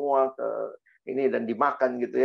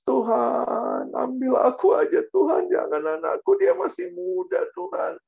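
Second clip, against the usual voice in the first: high-pitched mock sobbing plea; imitated tearful wailing; 12 semitones higher, 3 dB louder than usual. Mother pleading beside her child's coffin, imitated tearful wail. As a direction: act out a wailing mother begging God. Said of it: "mamanya di samping peti itu ya nangis-nangis".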